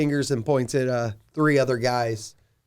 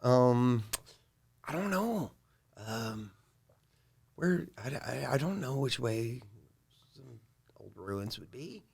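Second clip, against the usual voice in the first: unsure guide Lander. Acted. Guide admitting he's lost, unsure and fading.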